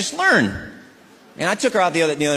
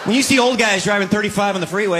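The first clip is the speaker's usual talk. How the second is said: usual speaking voice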